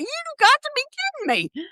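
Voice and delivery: high-pitched mock disbelief; acted shock, voice jumping up high; incredulous. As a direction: squeal out the outrage, way up high. Incredulous retort to Bob.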